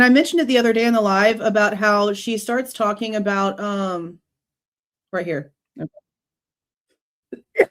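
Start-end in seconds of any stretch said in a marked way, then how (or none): 5.8-7.7 throat-clearing coughs; rough hacking cough; coughing fit. Real, not acted. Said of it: "I'm sorry I'm coughing so much".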